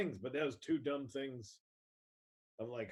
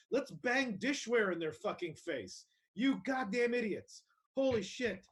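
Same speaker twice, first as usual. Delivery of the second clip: raised-pitch sarcastic rant; scornful, incredulous mimicry; mocking outrage; 5 semitones higher than usual. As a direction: jeer at them in mock concern, voice climbing. Mocking pots-and-pans appreciation for workers.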